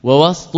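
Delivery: وسط as "wast", no vowel after the s; ووسط with a vowelless seen; silent middle consonant. Shown as a demonstration. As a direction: say ووسط with s running straight into t. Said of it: "بالسكون".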